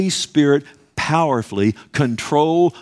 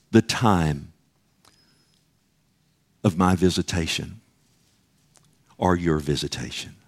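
grave lament; 6 semitones lower than usual. Acted. Quoting Jesus's lament.

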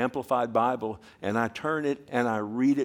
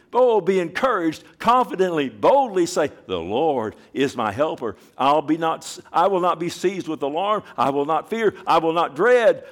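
louder, higher, building; bold declaration; 5 semitones higher, 7 dB louder than usual. Real, not acted. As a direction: declare it boldly to the church. Preacher building confident declarations.

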